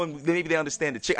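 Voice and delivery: ordinary talk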